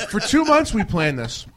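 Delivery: ordinary talk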